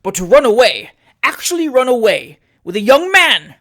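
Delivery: very loud, rising to a high protest; appalled, forceful reproach; scandalised remonstrance. A narrator's reading. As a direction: remonstrate loudly, punching the key words. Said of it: "remonstrated the mayor".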